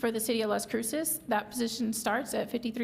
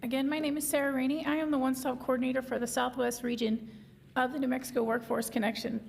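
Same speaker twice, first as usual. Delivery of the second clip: hoarse, strained voice; losing voice. Real, not acted. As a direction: speak with a hoarse, fading voice. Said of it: "I'm losing my voice today".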